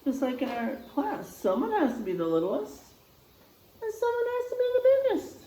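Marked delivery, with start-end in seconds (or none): 3.8-5.4 very high, fast, playful; big emphatic voice. Shown as a demonstration. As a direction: stretch up for biggest. Teacher contrasts littlest and biggest.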